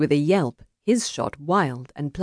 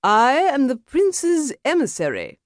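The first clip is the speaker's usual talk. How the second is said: high, testy declaration; 10 semitones higher than usual. A narrator's reading. Emissary asserting his rank to a duelist, testily. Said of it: "Mister Hopscotch said testily".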